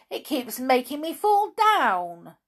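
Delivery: high, loud cross complaint; raging cry. Acted. Amelia Jane furious at falling down again. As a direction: complain in a temper. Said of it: "cried Amelia Jane in a rage".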